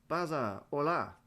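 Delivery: put-on light Spanish accent, friendly hello; Spanish-flavoured footballer. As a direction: greet him like a cheerful Spanish star. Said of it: "We don't know why he speaks like that".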